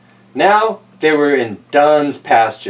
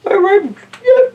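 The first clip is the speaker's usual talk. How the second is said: much higher voice; squeaky exclaim. Acted. A passenger reacts to the splash, squeaky. Voicing a character.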